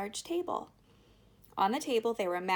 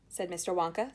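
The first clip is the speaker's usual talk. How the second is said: ordinary delivery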